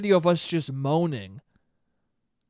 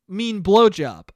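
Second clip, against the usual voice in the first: ordinary delivery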